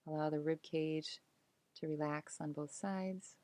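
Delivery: calm meditation voice with long pauses; unhurried, soothing body-scan narration, letting phrases settle; guided relaxation. Acted. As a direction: guide them gently into release, phrase by phrase.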